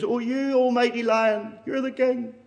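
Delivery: high, timid rabbit voice; frightened prey animal. Acted. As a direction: squeak it out, trembling with fear. Frightened rabbit answers lion.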